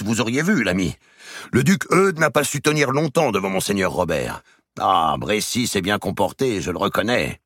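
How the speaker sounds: loud banter after the joust; hearty knightly chatter; boisterous knights. Acted.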